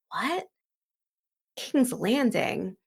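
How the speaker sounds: high, stunned "What?", then a slow, puzzled "King's Landing?"; mock shocked double take; startled disbelief. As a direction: blurt it out caught off guard. Dunk startled by the boy's news.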